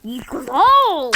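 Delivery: drawn-out loud battle cry. Acted. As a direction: stretch it into a big war cry. Game battle cry shout.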